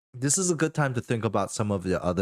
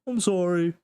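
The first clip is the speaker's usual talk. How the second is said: higher, lightly voiced [name] sorry; sheepish apology; 10 semitones higher, 3 dB louder than usual. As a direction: say sorry after squeezing too hard.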